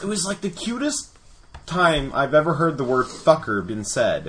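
normal talking voice

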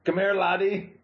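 hammed-up Scottish brogue; mock Scottish accent, as a fantasy dwarf. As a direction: play a stock Scottish dwarf. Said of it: "like the Scottish brogue".

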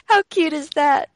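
high, loud delighted coo. Real, not acted. Cooing over baby.

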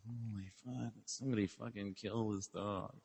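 weary bulldog. Retold or imitated. Big bulldog fed up with the yappy dog, soft weary grumble. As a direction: mutter it like the tired big bulldog. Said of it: "Frank, the big bulldog".

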